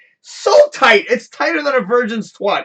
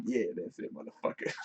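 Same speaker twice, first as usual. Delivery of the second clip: low, much softer, breathy gloating under the breath; smug whispered gloat; 13 semitones lower, 18 dB quieter than usual. Retold or imitated. Sitting in the chair watching customers carry groceries, whispered gloat. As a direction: savor it quietly, half laughing.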